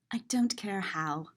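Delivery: whispered impatience; hushed, unvoiced shooing line. A narrator's reading. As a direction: breathe it out, exasperated.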